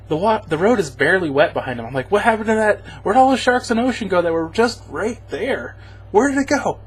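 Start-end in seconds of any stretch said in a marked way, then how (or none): 2.1-5.8 high incredulous what-happened voice; baffled disbelief. Retold or imitated. Viewer mocking the movie, high baffled disbelief. 6.1-6.8 high, baffled take of the question; incredulous. Retold or imitated. Viewer asking where the ocean went, high disbelief.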